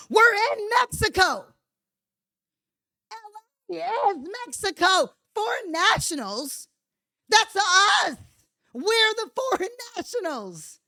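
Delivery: shrill-pitched sarcasm; high mocking rant. Real, not acted. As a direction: squeal it in mocking outrage.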